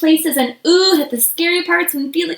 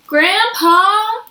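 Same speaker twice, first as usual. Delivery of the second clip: calling out; 8 semitones higher, 4 dB louder than usual. Acted. Child calls out in story.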